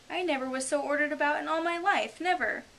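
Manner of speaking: high, loud, emphatic complaint; put-upon Alice. Acted. Alice thinking as she follows the Griffin, put-upon. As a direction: protest at being ordered about.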